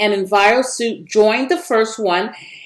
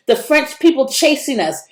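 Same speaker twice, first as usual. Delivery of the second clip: usual speaking voice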